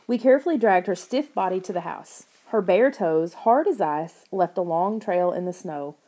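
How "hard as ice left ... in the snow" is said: ordinary delivery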